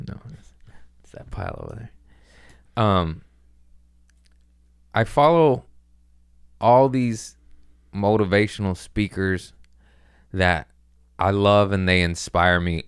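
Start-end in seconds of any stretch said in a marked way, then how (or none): none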